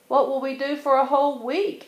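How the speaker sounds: high and fast, anxious little cubs; fretting cubs. A narrator's reading. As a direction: rush the question like worried kids. Cubs fretting about staying away.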